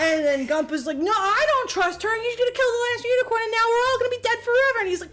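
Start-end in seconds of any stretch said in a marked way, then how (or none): 1.0-4.8 high, rising frantic whine; mock panic. Gump frets that the unicorn will die, voiced in mock panic. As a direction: spiral into hammed-up doom.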